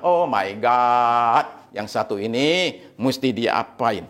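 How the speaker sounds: slow, drawn-out exasperation; exasperated God. Acted. God despairing over such a prayer, exasperated. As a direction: stretch it out like a mock lament. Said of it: "saya tepok jidat, elus dada, dan bilang".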